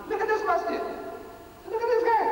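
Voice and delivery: high, ringing call to look; rousing the audience to look; excited. Real, not acted. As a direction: point and exclaim, pitch soaring. Pointing out mosque and man.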